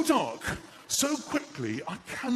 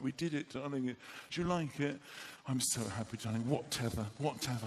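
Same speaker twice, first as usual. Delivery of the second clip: quiet, lower dreamy lovers' talk; soft tender murmur; 5 semitones lower, 7 dB quieter than usual. Retold or imitated. Wife and husband whispering happily on moving day, quiet. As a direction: murmur it, hand in hand. Tender couple exchange retold softly.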